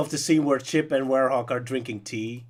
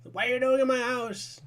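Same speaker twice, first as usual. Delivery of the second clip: high-pitched mock yell, no real volume; play-acted yell, pitched way up; 12 semitones higher than usual. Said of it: "constantly yelling at them just off screen".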